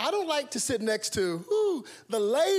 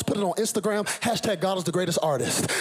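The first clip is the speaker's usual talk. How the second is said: ordinary talk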